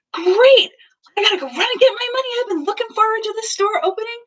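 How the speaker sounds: hammed-up eager mom, high and quick; overjoyed. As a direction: burst out thrilled and rushing. Mom turning toward daughter eagerly.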